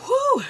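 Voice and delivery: sharp high loud exhale; pained whew. Real, not acted. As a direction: blow out the burn. Reacting to pepper heat.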